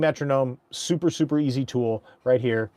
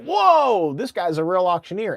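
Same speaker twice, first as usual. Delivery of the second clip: impressed audience; 7 semitones higher, 5 dB louder than usual. Retold or imitated. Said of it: "the audience is like".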